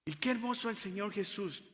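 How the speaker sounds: ordinary talk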